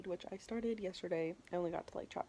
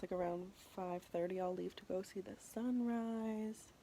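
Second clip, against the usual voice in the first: ordinary delivery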